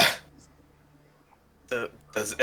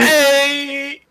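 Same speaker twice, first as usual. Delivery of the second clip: high, loud, drawn-out shout; shouted call; 14 semitones higher, 6 dB louder than usual. Acted. Player casting Thunderwave on the group, shouted call. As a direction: holler it to blast them away.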